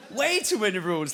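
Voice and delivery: ordinary talk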